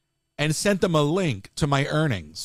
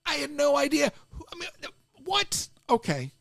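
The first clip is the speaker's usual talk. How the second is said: [name]; 8 semitones higher than usual. Retold or imitated. Streamer slipping into a hype-man bit, yelped "what?". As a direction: hype man yelp, then settle. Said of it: "That was my [name] impression".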